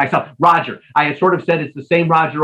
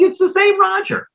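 surprised realisation. Real, not acted. Host realising story links up.

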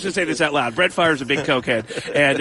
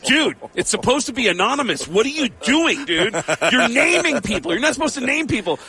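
mock outrage, pitched way up; angry internet commenters; 8 semitones higher than usual. Internet fans scolding Tom Arnold, high mock outrage. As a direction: squeak out the indignant scolding. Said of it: "people will go".

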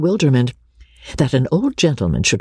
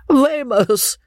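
put-on high-pitched, delighted old baronet; gleeful one-word exclamation; 13 semitones higher than usual. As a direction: crow it with relish, pitched way up.

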